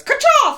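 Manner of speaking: high, loud "ka-cha" crack; imitated bursting noise. Host mimicking the baby bursting through the spine, explosive sound effect. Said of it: "baby freaks out and goes".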